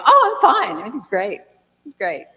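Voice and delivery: high, loud put-on cheer that settles; fake fine. Answering a friend's "how are you" with forced cheer. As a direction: chirp the answer falsely, hiding a hard time. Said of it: "someone says".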